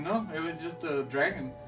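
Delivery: ordinary talk, nothing marked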